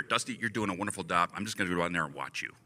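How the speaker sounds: low, soft, quick aside; hushed, hurried reassurance, acted; imitated murmur in ear. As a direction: say it discreetly into someone's ear. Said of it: "in my ear".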